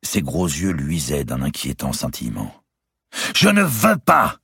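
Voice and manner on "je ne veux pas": high, loud, slow shout; cried out. A narrator's reading. Louis shouts at Clémence, crying out. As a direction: bellow the refusal. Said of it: "cria-t-il".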